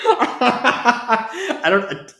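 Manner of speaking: high words squeezed out of giggles; laughing. Real, not acted. Trying to stop the giggles, still laughing. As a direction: try to speak while still laughing. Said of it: "I gotta stop laughing".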